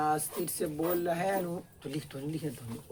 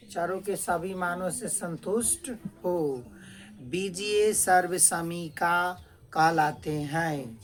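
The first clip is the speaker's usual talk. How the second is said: deliberate classroom dictation, phrase by phrase; measured and projected, with pauses; slow dictation; 4 dB louder, slower than usual. Real, not acted. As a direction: hold the phrase endings for students writing. Teacher dictates for students to write.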